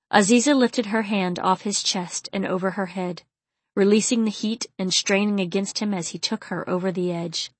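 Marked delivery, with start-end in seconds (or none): none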